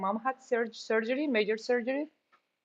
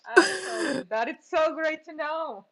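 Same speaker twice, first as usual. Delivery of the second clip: higher, on the edge of tears; teary; 7 semitones higher than usual. Real, not acted. Moved that Janine's mom is home, voice teary. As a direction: let the joy choke you up.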